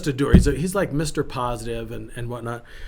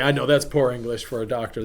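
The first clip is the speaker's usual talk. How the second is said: ordinary talk, nothing marked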